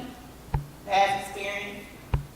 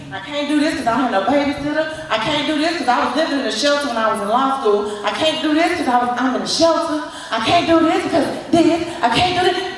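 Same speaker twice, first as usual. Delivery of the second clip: louder, building litany of excuses; mock excuses rising; 2 semitones higher, 5 dB louder than usual. Speaker mocking his old excuses, building louder. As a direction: hammer each excuse harder and harder. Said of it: "I'm giving all these excuses".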